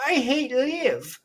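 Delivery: put-on high, slow Irish-accented whine; slow mock Irish accent. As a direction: mimic Rory's Irish lilt, drawn out. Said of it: "however he says it in his Irish accent".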